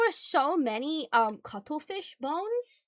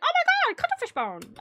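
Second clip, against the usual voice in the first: much higher, loud delighted gasp; excited exclamation; 6 dB louder than usual. Retold or imitated. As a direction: squeal it like a thrilling find. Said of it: "I was like".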